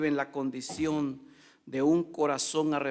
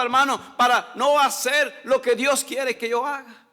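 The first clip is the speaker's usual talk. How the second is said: loud, higher impassioned sermon; fiery preaching; 7 semitones higher, 5 dB louder than usual. Acted. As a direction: preach it out to the whole congregation. Preaching at full force. (predicación fervorosa, fuerte y alta)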